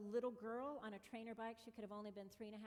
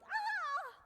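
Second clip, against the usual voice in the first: high loud startled cry; woman's startled shout at a cyclist; startled yell; 11 dB louder than usual. Retold or imitated. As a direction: let out a sudden shriek of alarm. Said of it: "the woman who was in front yelled".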